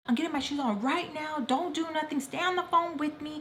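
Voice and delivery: high-pitched urgent phone voice; urgent retold orders. Mom's friend coming to get them, urgent. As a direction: imitate an alarmed adult taking charge, pitched high.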